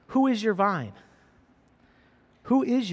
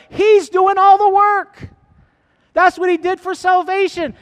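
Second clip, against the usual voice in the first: fiery preaching; 6 semitones higher, 7 dB louder than usual. Acted. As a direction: preach it out to the congregation at full force. Preacher builds to full force.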